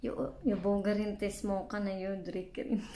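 speaking through laughter; giggling between words; laughing while talking. Real, not acted. Seen 0: Open-mouth laughing during the words; lip pulled for the pouch only later.

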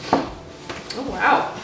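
normal talking voice

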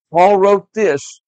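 slow it right down to make them listen; slow emphasis. Acted. Teacher to children, each word slow and loud. Stressing Paul's letter to kids.

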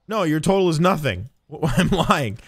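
ordinary talk

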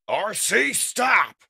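very loud, high commanding shout; bellowing. Acted. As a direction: bellow the order. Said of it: "Prime bellows".

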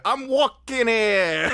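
put-on New York accent. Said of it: "I still like the".

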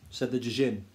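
plain reading voice

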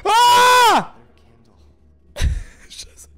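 high loud scream; terrified scream. Real, not acted. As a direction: scream at the jump scare. Jump scare.

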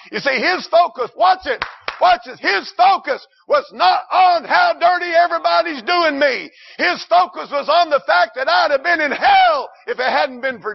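high, loud climactic preaching; preaching at full force. Real, not acted. Preacher rising to a shouted climax for his church. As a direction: shout the point home to the church.

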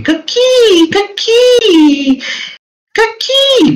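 very high-pitched creature call; creature cry. Acted. Game master as the approaching creatures, high creature cry. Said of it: "like saying".

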